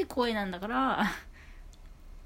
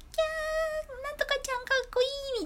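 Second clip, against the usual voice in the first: much higher, fast shrieking fangirl voice; fan squeal. Retold or imitated. Fan shrieking over a cute idol at a screening, high squeal. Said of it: "絶叫上映みたいなやつ".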